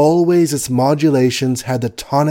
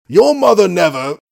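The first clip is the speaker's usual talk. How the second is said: Your punched higher and louder; emphatic; 7 semitones higher, 5 dB louder than usual. A narrator's reading. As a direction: start the protest loud and high. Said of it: "Colonel Hugonin paused".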